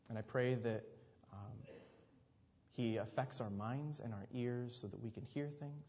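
soft, hushed congregational prayer; quiet, reverent prayer. Real, not acted. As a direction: pray softly, with pauses between thoughts. Leads a prayer.

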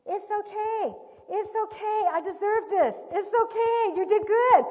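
high, loud tearful child imitation; imitated crying. Crying son reassuring crying parent, imitated loud high wail. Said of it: "he was crying".

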